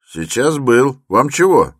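high, loud retort; brusque porter. A narrator's reading. Porter answers Raskolnikov's questions, brusque and loud. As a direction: answer loudly and impatiently.

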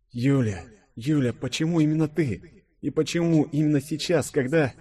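high-pitched grieving questions; anguished lament. A narrator's reading. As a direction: plead inwardly with the lost friend. Grieving inner lament, near tears. (горестная мольба высоким голосом)

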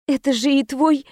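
understated break in the voice. A narrator's reading. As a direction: let the voice catch on grief. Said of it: "Голос сорвался".

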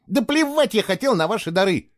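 high, heated protest; angry retort. A narrator's reading. Boy rejecting the accusation about the gifts, heated. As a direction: hurl it back defiantly.

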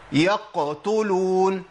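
"يقتلون" with the qaf hammered, very slow; exaggerated qaf. Shown as a demonstration. Teacher showing the overdone mistake, drawn out. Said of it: "لا".

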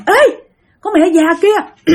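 high, drawn-out mock jeer; retold high-pitched heckling; imitated cursing yell. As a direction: act out calling someone out abusively, slowly. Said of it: "ba chửi nha".

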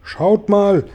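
high, loud call to the others. A narrator's reading. Centipede calls friends to look across the water, loud call. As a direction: call out to get everyone to look. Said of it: "rief Tausendfüßler".

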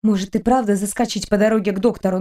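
plain reading voice

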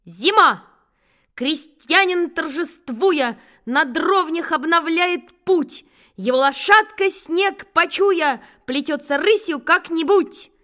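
loud, slow schoolboy poem recital; brisk recitation. Acted. Boy recites Pushkin at the blackboard, slow and loud. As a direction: declaim each line slowly and loudly at the blackboard. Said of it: "бодро завёл".

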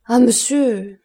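anguished cry, not a real murmur; distressed plea. A narrator's reading. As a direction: appeal to him in dismay. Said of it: "murmura-t-elle".

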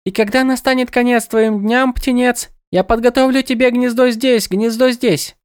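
high, lilting birdlike goddess; sing-song, echoing her own words; bird goddess voice. A narrator's reading. Goddess speaks to Kras.

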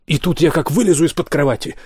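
high-pitched, animated bragging; boastful storytelling. A narrator's reading. Olli brags about scaring from under the bed, excitedly. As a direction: tell it with a big lifted voice. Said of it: "услышал он голос Олли, доносившийся откуда-то издалека".

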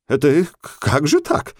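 put-on high, faltering bewildered old man; растерянно. A narrator's reading. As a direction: stammer it out, lost and confused. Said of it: "растерянно отозвался Наум Моисеевич". (растерянный высокий голос)

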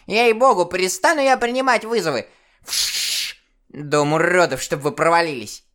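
высокий, скрипучий старушечий, через помехи; сыграй бабку-диспетчера в шипящем динамике; старушечий голос в динамике. Acted. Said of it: "старушечий голос". (старушка в динамике с помехами)

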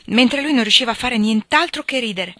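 ordinary delivery, nothing marked

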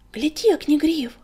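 high, loud, startled alert; urgent gasp to a friend to look; alarmed, forceful hushed cry; frightened stage whisper. A narrator's reading. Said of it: "испуганно прошептал Крутобок".

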